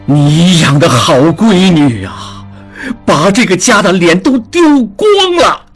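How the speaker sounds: high, shouted rebuke, slowing to bitter scorn; furious. A narrator's reading. Angry father shouts at wife over their daughter's shame. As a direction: roar at the women to stop crying. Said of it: "江父怒道".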